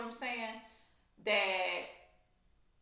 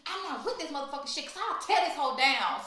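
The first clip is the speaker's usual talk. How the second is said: high, fast, fired-up retelling; a hammed-up angry rant that ends loud; heated complaint; 7 semitones higher, 3 dB louder, faster than usual. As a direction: rattle it off furious and offended. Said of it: "I was like".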